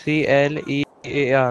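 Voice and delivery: one letter at a time; measured letter-by-letter dictation, then the word; slow spelling. Real, not acted. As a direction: spell it out slowly for a listener.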